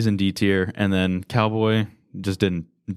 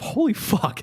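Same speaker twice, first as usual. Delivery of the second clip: excited; slower than usual. Real, not acted. Reacting to shocking scene.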